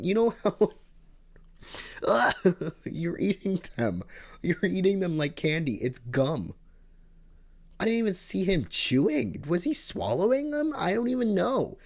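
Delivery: bewildered he's eating gum like candy; exasperated and amused at once; laughing disbelief. Real, not acted. Ranting about gum-chewing kid.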